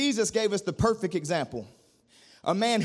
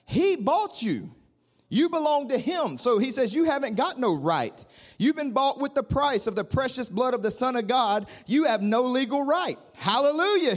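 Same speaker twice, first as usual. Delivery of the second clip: fervent preaching; 4 semitones higher than usual. Acted. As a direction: preach it with rising fire. Preaching at full force.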